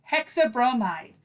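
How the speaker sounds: normal talking voice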